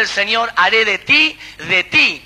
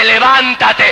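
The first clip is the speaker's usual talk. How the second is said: commanding call; 7 dB louder, slower than usual. Acted. Voice of the Almighty commanding.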